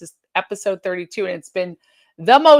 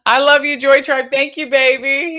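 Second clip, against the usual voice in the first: excited; 7 semitones higher, 8 dB louder than usual. Real, not acted. Greets the viewers, thrilled.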